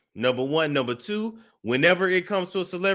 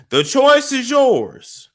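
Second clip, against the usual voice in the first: high, loud, slow, drawn-out threat; menacing threat; 10 semitones higher, 8 dB louder, slower than usual. Pretend. Predator's threat to the girl, loud and slow. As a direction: stretch it out like a villain giving an ultimatum.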